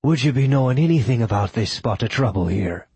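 put-on Irish brogue; Irish accent tinged with Icelandic; faux Irish accent. A narrator's reading. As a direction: ask it in your best fake Irish. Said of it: "his best faux Irish accent".

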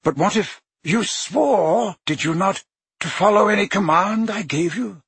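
lightly voiced old headmaster, firm; a hint of the stern old wizard pressing Harry; Dumbledore's voice. A narrator's reading. Headmaster pressing Harry to obey, lightly voiced stern.

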